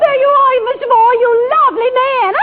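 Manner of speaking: ordinary talk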